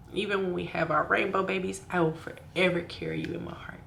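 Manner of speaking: soft, fading, breaking into whisper; tearful whisper. Real, not acted. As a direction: let the voice give out. Tearful goodbye to baby.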